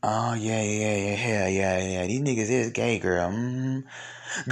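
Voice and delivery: run-on mock murmurs of agreement; imitated agreeing girlfriend. Host plays the friend agreeing with gossip, mock knowing agreement.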